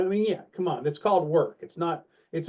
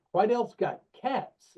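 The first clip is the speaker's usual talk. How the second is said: slow, higher, baffled question "Quidel's got cats?"; puzzled echo; 6 semitones higher, slower than usual. Retold or imitated. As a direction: repeat "cats" back slowly, puzzled and rising. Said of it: "And I'm like".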